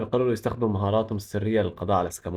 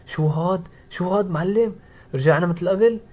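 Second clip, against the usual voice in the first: wife's mildly angry, higher-pitched questioning; 6 semitones higher, slower than usual. A narrator's reading. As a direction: confront him, pitch raised, in a narrated wife's anger. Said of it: "وبتقول له بغضب".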